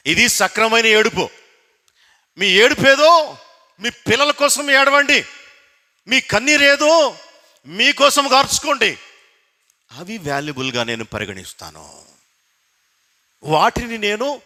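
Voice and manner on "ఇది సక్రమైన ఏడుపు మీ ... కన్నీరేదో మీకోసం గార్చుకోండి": preacher urging the people not to cry for worldly things, full force; fiery preaching. Acted. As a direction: pound each line home to the congregation.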